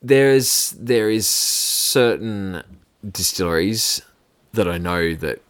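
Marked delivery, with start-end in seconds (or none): none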